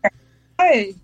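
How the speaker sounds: distant voice on speakerphone. Real, not acted. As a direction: talk from a phone lying away from you. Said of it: "Oh, that sounds a lot better".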